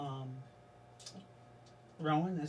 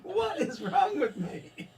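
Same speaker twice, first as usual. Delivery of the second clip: slow, high, laughing Scottish brogue; laughing Scottish accent; 10 semitones higher, 3 dB louder, slower than usual. Acted. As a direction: giggle through it in a Scottish accent. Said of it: "can't get rid of this motherfucking accent".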